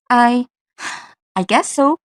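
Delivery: "I," then an audible sigh before "I guess so."; sigh then speak. Shown as a demonstration. Student demoing an out-breath, sighing. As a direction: breathe out heavily, then answer. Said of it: "an out breath as the speaker starts talking".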